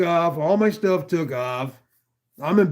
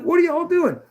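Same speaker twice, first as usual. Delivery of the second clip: much higher again, stunned protest; incredulous; 12 semitones higher than usual. Retold or imitated. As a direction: pitch it way up in disbelief. Repeated protest to paramedics.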